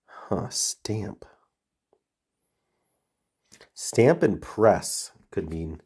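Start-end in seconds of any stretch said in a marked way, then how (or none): 0.1-1.2 whispering to self; breathy murmur to oneself; whispered. Real, not acted. Thinking aloud.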